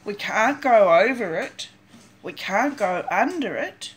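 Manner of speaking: ordinary delivery, nothing marked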